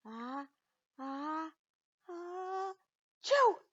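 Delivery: rising ah-ah-ah into a loud sneeze; building sneeze. Acted. Hamster sneezes.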